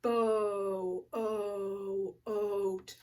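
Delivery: "Bo, o, oat" with long oh held in pulses; slow sounding-out. Shown as a demonstration. Teacher checking boat for its vowel, slowly sounded out. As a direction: stretch boat on its oh. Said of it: "Let's say 'em slow".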